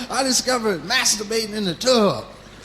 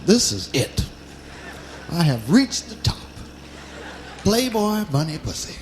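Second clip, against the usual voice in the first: smug triumph; 5 semitones lower, 4 dB quieter than usual. Retold or imitated. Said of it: "I said".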